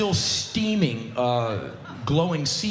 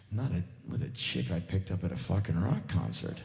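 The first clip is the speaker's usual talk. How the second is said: low, softer muttered aside; inner thought; 8 semitones lower, 6 dB quieter than usual. Pretend. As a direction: mutter it like a secret. Guy's hidden inner thought.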